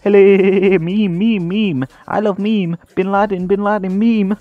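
high, loud cartoonish babble; silly blurted gibberish. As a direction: blurt nonsense in a squeaky, loud put-on voice.

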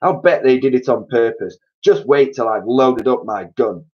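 loud, furious threat; angry threat. A narrator's reading. Mister Twit threatening the birds, loud angry threat. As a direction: vow to get the gun. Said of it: "yelled Mister Twit".

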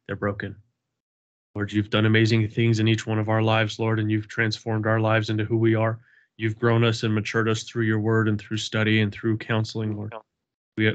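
ordinary talk, nothing marked

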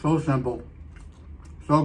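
talking with food in mouth; speaking between bites; chewing while talking. Real, not acted. Seen 0: Sandwich bite at -7, still chewing while speaking, then chip.

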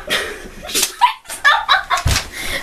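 airy high-pitched laugh; breathy laughing fit. Real, not acted. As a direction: dissolve into laughter. Breaks up laughing.

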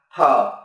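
"thở" said lightly, pitch dropping; light falling t. Shown as a demonstration. Teacher showing a light falling "thở" to students. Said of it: "phát âm nhẹ và đi xuống".

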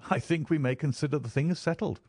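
lightly merry, settling the deal. A narrator's reading. Holmes agrees to share rooms, lightly merry. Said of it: "he cried with a merry laugh".

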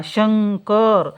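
शंकर stretched very slowly so the n sound is heard; teacher spelling out शंकर slowly. Shown as a demonstration. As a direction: draw out शंकर for the class. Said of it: "कशाचा उच्चार होतो?".

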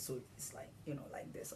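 whisper. Retold or imitated. Imitating broken wiper.

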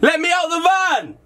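high-pitched yell to be let out; desperate shouting. Acted. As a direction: shout through the van doors. Locked in van, calling out.

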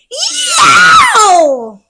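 high, loud yelp of a scream; armadillo's hammed-up scream; mock scream. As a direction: let out a hammed-up yowl. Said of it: "blood-curdling scream".

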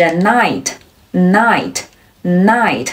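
"knight" said slowly like "night"; silent K. Shown as a demonstration. Teacher giving another example, "knight" with silent K. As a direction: begin "knight" on the N, with pauses between. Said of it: "开头的K都是不发音的".

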